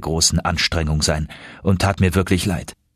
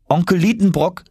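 higher, louder call of his name; 10 semitones higher than usual. Acted. Nephew calling uncle loudly again, high call. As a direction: call out to wake him from his work. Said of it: "rief ich nochmals laut".

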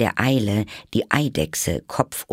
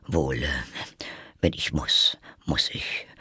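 gloomy, whispered resignation; 6 semitones lower, 5 dB quieter than usual. A narrator's reading. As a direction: sigh it out in a whisper. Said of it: "sagte der König trübsinnig".

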